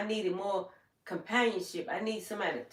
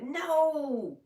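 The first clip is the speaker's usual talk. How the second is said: shocked no; 6 semitones higher than usual. Retold or imitated. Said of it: "I said".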